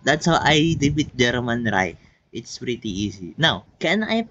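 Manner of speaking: ordinary delivery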